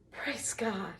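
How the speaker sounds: soft breathless whisper of thanks; whispered praise. Retold or imitated. Woman thanking God under her breath, whispered. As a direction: breathe it out like a private prayer. Relief after stranger offers help.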